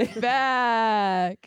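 laughing. Pretend. Mock podcast intro echo.

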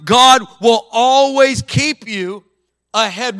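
loud, ringing declaration over the church; fiery sermon blessing; preaching at full force. Acted. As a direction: preach it with rising power.

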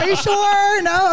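very high, coaxing question; mock pleading check. Comic asking friends for a pass, mock pleading. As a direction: ask in a squeaky-high hopeful voice, half laughing. Retold pleading for permission, joking.

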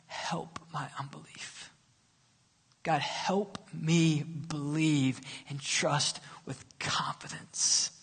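soft slow whispered prayer. Acted. Preacher voicing the congregation's prayer, whispered. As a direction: pray it under your breath slowly.

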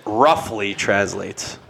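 slow, drawn-out quote of a hedge; slow mocking repeat; mock quoting. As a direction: stretch each word to point out the weasel phrase. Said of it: "Kale just said".

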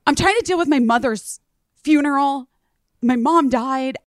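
high, overwrought caller voice; imitated distress. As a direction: act out a man pleading that he's overwhelmed. Distraught caller retold, bereaved.

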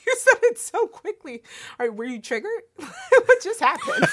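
high, loud, through laughter; laughing tease. Real, not acted. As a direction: tease him while cracking up. Teasing Andrew's very quick answer.

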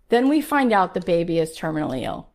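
ordinary delivery

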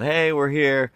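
high-pitched calling out; mock yell. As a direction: hail them from a distance. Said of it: "Nobody yelled".

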